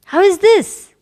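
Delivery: high, loud rhetorical question; emphatic rhetorical question; exclaimed question. Acted. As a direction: ask it with exaggerated wonder. Repeated exclamation.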